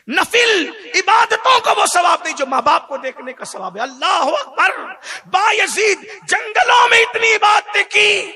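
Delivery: loud, high preacher's cry; loud sermon climax with laughs; fiery preaching. Acted. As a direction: thunder it at the congregation. Preaching at full force.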